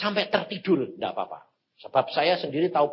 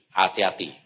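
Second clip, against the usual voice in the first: ordinary talk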